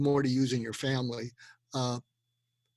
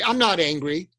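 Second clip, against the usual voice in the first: defensive denial; 3 semitones higher, 7 dB louder than usual. Pretend. Imagined angry denial.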